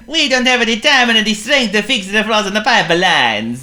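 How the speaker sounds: high, loud weary Italian plumber; Italian accent. A narrator's reading. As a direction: complain in a thick Italian accent. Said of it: "wailed Bruno".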